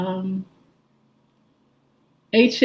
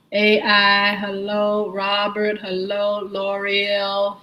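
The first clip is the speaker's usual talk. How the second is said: ordinary talk, nothing marked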